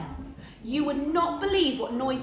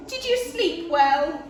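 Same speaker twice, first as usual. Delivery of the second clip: cheerful Missus Bear; 7 semitones higher, 6 dB louder than usual. A narrator's reading. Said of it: "she said".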